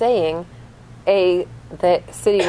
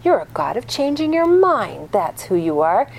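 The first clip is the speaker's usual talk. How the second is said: normal talking voice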